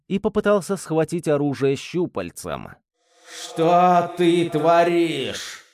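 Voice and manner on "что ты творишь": slow, thundering angry demon; furious demon roar. Acted. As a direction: roar at him from inside his head. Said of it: "яростно прогремел демон в моей голове".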